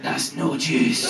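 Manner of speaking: slow, voiceless Scottish rasp; hungover Glaswegian girlfriend. Retold or imitated. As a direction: imitate a wrecked, disgusted croak. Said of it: "She went".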